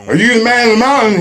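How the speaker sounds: a tired climber eagerly hailing the sage; eager question. Retold or imitated. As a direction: blurt it out breathlessly hopeful. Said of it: "I'm like".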